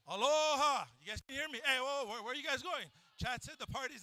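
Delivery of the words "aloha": loud, high call to the room; calling out aloha. Real, not acted. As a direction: greet the whole crowd with a big aloha. Greeting the crowd loudly.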